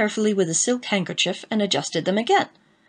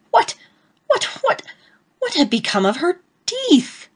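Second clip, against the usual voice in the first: very high squeaky what, what; shrieking horror; 9 semitones higher than usual. A narrator's reading. Narrator discovering his wife has no teeth, high shriek.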